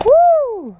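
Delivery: high whooping cheer; excited whoop. Real, not acted. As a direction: whoop it up, thrilled. Cheering during the game.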